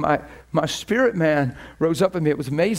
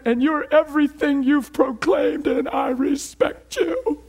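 high, slow crying voice; tearful father; 9 semitones higher, slower than usual. Retold or imitated. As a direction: let the father's voice break into tears. Father breaking down, honoring son.

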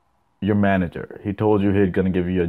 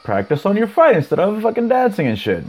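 mock scolding; 7 semitones higher than usual. Host imagining jeering a knocked-out fighter, high mock scolding. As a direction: scold him in a rising, hammed-up high voice. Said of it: "I'll be like".

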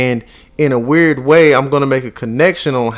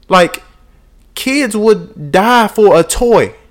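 higher, emphatic scolding lecture; father lecturing son about gratitude, higher emphatic voice; lecturing dad; 5 semitones higher than usual. Retold or imitated. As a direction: drive the lesson home to the kid. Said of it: "And I'm like".

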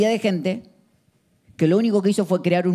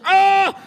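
high, loud strained yell; one hammed-up lifting shout; mock gym yell; 13 semitones higher, 6 dB louder than usual. Gym guy yells again.

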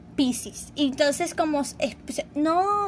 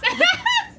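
high squealing laugh; high burst of laughter; 4 dB louder than usual. Real, not acted. Laughing at her own insult.

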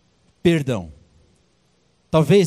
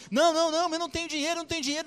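much higher and softer, begging; pleading debtor; 11 semitones higher, 4 dB quieter, much faster than usual. Acted. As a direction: whimper out a plea. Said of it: "o cara falou assim".

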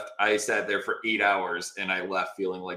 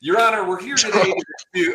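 higher, louder mock courtroom opening; mock oblivious lawyer; 10 semitones higher, 5 dB louder than usual. Other lawyer addressing arbitrator right after her request, oblivious. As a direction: parody a lawyer ploughing on regardless. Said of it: "next sentence out of his mouth".

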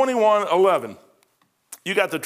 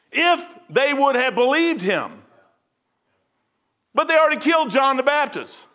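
raised, ringing preacher's climax; preaching at full force; 4 semitones higher than usual. Acted. Preacher to his congregation, raised and fervent. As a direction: drive the point home to the congregation.

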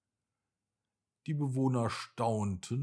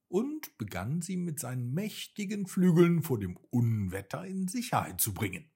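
ordinary delivery, nothing marked

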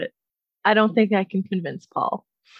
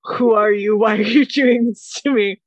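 louder, half-joking protest at the cat; playfully overwhelmed exclamation; mock exasperated outburst; 7 dB louder than usual. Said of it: "And I was like".

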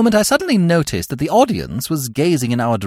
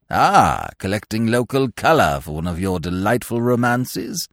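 put-on pompous old lord; 6 semitones lower than usual. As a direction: speak down from great dignity, deep and measured. Pompous peer speaks.

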